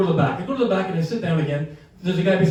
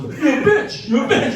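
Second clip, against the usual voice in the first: mock angry insult; 7 semitones higher, 4 dB louder than usual. Acted insult between the spouses.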